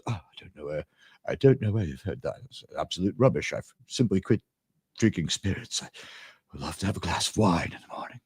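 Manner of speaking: put-on very low, hushed, tipsy old-actor voice; soft, low rumble of a drunk star; Richard Harris impression. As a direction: murmur it deep and quiet, tanked up. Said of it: "he has this incredible voice".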